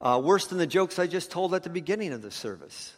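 ordinary talk, nothing marked

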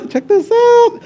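high, cartoonish Noah voice; sly Noah. Noah eyes the sheep for sacrifice, high and sly. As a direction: chirp it in a high mocking tone. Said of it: "and Noah said".

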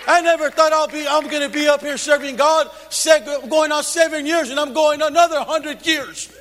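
ordinary delivery

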